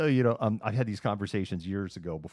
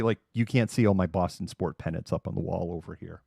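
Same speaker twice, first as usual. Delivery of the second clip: ordinary talk, nothing marked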